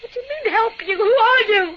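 high, loud tearful shout; sobbing and shouting in fear; panicked crying shout. Acted. Talking to the mystery caller.

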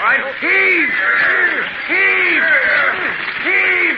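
high strained shouts while pushing; heaving effort shouts. Acted. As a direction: call out each heave with effort. Pushing wagon over the cliff.

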